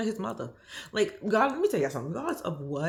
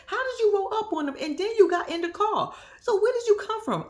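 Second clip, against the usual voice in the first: higher, louder disbelieving questions; incredulous rant; 12 semitones higher, 4 dB louder than usual. Real, not acted. Vlogger grilling Donna about the muumuu, higher louder disbelief. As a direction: fire the questions at her in disbelief.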